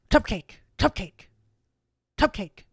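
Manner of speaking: high, loud chanted demand; little girl yelling her order; yelling child. Acted. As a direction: shout it like a greedy little girl. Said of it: "Vana yells up".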